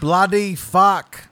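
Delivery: put-on loud, slow English accent "Bloody fuck". English accent, feeding his friend British phrases. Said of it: "do some more English".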